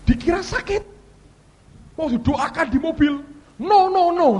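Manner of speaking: seruan panik jenaka. Retold or imitated. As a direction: squeal it out like a comic refusal. Acting out comic refusal of prayer. (seruan melengking pura-pura panik)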